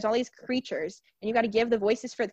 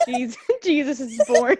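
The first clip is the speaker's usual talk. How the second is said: laughing repeat; 7 dB louder than usual. Real, not acted. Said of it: "I said".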